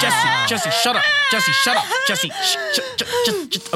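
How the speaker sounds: high bawling infant cries; scream-cry like a baby; baby wail. Acted. Baby wails while dad shushes.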